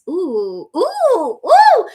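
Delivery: escalating high, loud whooping oohs; rising excited oohs. Real, not acted. Host cheering a viewer's affirmation, excited whooping. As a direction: start with an ooh and climb to a loud squeal.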